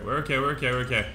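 normal talking voice

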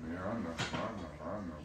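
soft, low murmur to calm an excited dog; soothing the dog. Real, not acted. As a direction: hush him gently with quick murmurs.

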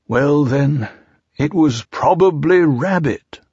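drawn-out storybook bear; Pooh. A narrator's reading. Pooh shifts blame.

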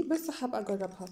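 ordinary talk

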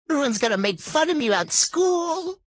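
high-pitched, dramatic wail; hammed-up high whine; upset whine. Child dreading ridicule over new voice.

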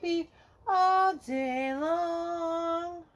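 plain reading voice

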